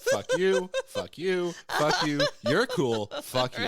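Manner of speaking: high, loud mock insults through laughter; laughing taunts. Acting out the clerics flipping the bird, laughing taunts. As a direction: toss out gleeful insults, laughing.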